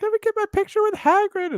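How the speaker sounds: high-pitched mock little-girl voice; putting on a small girl's high voice; imitated little girl. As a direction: pipe up like a little kid. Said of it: "these, like, little girls would".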